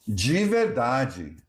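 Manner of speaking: De verdade with both de said as ji, slowly; Brazilian de as ji. Shown as a demonstration. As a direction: say it dji verdadji. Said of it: "D E in Brazil is often pronounced like G".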